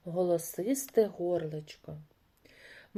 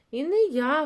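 high, louder refusal; raised storybook mouse shout; mouse calling out; 7 semitones higher, 6 dB louder than usual. A narrator's reading. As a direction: call back from play, ducking the job. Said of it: "гукає верть".

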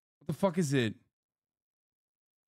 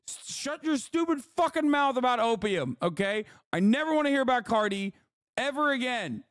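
much higher, furious ranting; real frustrated rage; 11 semitones higher than usual. Real, not acted. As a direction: blow up at the stupid app in real fury. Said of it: "channel the rage that I fucking have".

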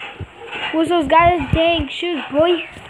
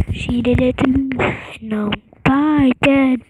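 dying voice; 6 semitones lower than usual. Acted. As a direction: fade out like a dying man. Dying character's final narration.

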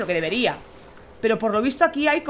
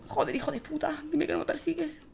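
much softer, tense hushed plea; frightened whisper; 2 semitones higher, 12 dB quieter than usual. Real, not acted. Player hides from a creature, whispering in fear. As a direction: hush it so the monster won't hear.

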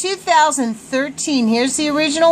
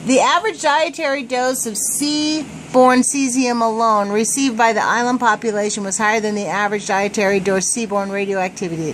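normal talking voice

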